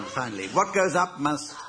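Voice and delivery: normal talking voice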